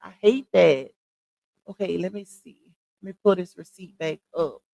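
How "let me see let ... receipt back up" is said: ordinary talk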